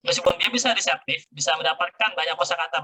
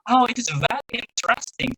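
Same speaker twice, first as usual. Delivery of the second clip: imitated native English; 7 semitones higher than usual. Student frets over not producing native speech, imitated native English. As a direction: gush it like a native English speaker. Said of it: "saya takut nggak seperti native".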